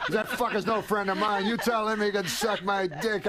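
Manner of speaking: high-pitched mock outrage; retold indignation. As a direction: imitate an offended comic disowning someone. Acted outburst in a full elevator.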